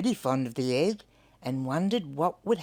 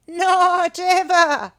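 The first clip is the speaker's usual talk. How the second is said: much higher, much louder, slow final cockatoo; 13 semitones higher, 10 dB louder, slower than usual. Acted. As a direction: squawk it like a verdict. Cockatoo declares.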